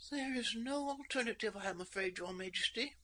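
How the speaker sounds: put-on high young woman, quiet and defeated; soft, high, resigned reply; high princess voice, softly resigned. A narrator's reading. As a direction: give in gently to the king. Girl resigned to arrest.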